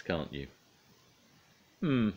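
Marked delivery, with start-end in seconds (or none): 1.8-2.1 musing hmm. Acted. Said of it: "exclaimed Mister Clumsy".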